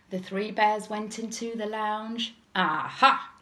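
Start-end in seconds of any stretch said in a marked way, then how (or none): 2.5-3.3 booming growled discovery; Daddy Bear. A narrator's reading. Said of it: "growled Daddy Bear".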